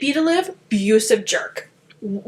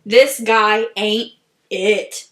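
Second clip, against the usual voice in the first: slow verdict; 2 semitones lower, much slower than usual. Real, not acted.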